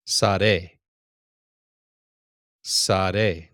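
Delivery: Sare said loudly with a falling end; Sare, loud teaching model; Hebrew word sare. Shown as a demonstration. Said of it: "Notice this one".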